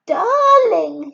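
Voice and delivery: high, loud, adoring darling; gushing fiancée. Acted. Helen gushing to her fiancé about flowers, high and adoring. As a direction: coo it at your sweetheart.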